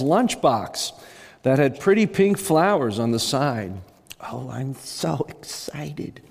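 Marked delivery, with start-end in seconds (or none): 4.3-6.2 breathless, slow whisper; excited whisper. Acted. Plump woman whispering her excitement on stage. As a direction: whisper it, thrilled to be up front.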